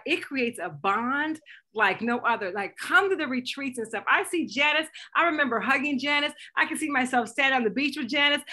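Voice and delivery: usual speaking voice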